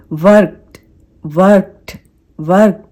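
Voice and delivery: loud "workt" for वर्क्ड; tiny t closing वर्क्ड; one-syllable वर्क्ड, no vowel after t; soft T ending. Shown as a demonstration. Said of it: "इन दोनों के बीच का एक sound".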